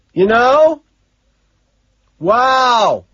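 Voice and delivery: high, loud exclamations; full-volume holler; shouting. A narrator's reading. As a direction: yell it over the noise. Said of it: "I shout".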